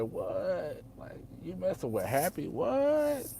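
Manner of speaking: high, incredulous what; can't believe his ears, voice jumping up; shocked disbelief. Retold or imitated. Brother reacting to his sister dating Happy, incredulous.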